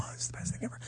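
hushed whispered aside. Retold or imitated. Kids whispering about a violent movie. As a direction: whisper it like a wide-eyed kid. Preschoolers quoted.

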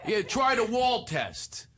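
dumb boxer. Acted. Ghost guide urging a newcomer, as a dumb boxer. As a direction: slowly urge him on like a dumb fighter. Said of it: "I played a dumb boxer".

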